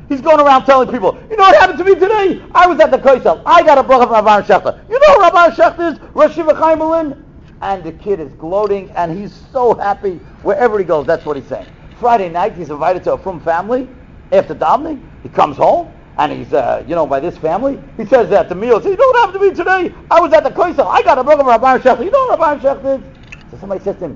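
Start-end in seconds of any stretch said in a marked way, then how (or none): 1.3-7.2 higher, louder, rushing with excitement; excited bragging. Retold or imitated. Thrilled boy retells his blessing. 18.8-23.0 excited bragging. Retold or imitated. Boy brags again at meal.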